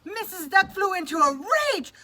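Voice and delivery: high loud storytelling; dramatic narration. A narrator's reading. Narrator telling the duck's rage, heightened. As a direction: build the drama.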